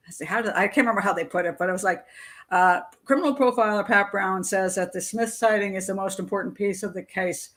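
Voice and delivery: normal talking voice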